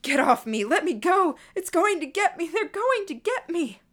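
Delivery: high, loud, frantic yelling; panicked screaming. A narrator's reading. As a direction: scream as if fighting off captors.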